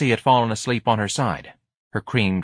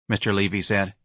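ordinary delivery, nothing marked

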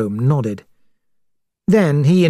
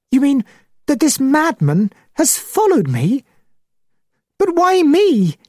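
very high, horrified disbelief; high, distressed gentleman, slowing on the final question; shocked; 13 semitones higher than usual. A narrator's reading. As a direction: let the shock push the voice right up. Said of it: "Mister Downes became weak with shock".

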